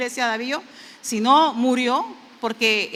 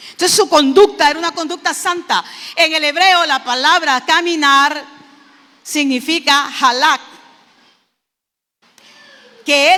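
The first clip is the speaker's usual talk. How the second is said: fast, loud, raised preaching voice; fervent preaching; 4 semitones higher, 9 dB louder than usual. Acted. Preacher exhorting the brethren about Enoch, loud and fervent. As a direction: preach it out to the congregation. Preacher explaining halak loudly.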